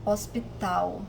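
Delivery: Hospital said slowly, final l sounding like u. Shown as a demonstration. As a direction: stress the ending of Hospital. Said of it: "the sound of the final L".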